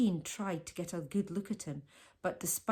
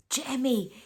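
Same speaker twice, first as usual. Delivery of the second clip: ordinary delivery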